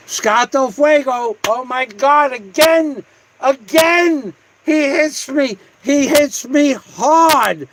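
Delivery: high, thrilled outburst; excited high exclaiming. Real, not acted. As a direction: squeal it like a big win. Excited reaction to donor.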